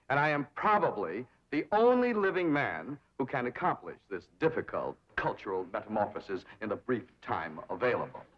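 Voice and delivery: ordinary talk, nothing marked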